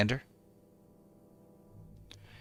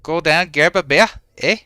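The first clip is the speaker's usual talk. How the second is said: put-on Minnesota accent; 4 semitones higher, 4 dB louder than usual. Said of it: "That was a Minnesota accent".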